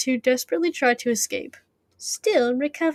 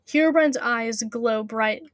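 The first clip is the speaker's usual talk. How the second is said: plain reading voice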